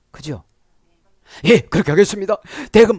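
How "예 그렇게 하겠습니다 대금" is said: practising a confession. Acted. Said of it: "막 이렇게 연습하고".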